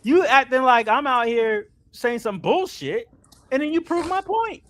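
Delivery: high, loud, exasperated outburst; frustrated rant. Real, not acted. Backer rants at the company over changed numbers, loud and frustrated. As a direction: raise pitch and volume, accusing them hotly. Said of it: "I'm so fucking frustrated".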